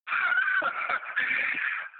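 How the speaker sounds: high-pitched imitation of jeering laughter; mocking laugh. Acting out them laughing at him.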